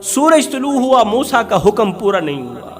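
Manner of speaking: ordinary talk, nothing marked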